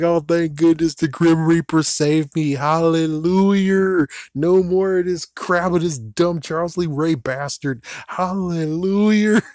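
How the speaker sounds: a hammed-up hallelujah of relief; mock relief. Imagined celebrity rejoicing that the Reaper saved him, mock jubilant. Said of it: "He was like".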